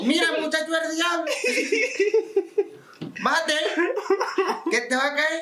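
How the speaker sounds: high-pitched scolding grandma voice; scolding Maíta. Retold or imitated. Maíta scolding the boy to come down, high scolding. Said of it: "Maíta me dijo".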